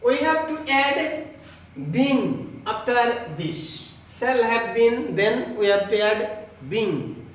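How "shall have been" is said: usual speaking voice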